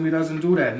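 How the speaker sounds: heated denial. Real, not acted. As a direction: put your foot down on it. Rising heat on the gang violence.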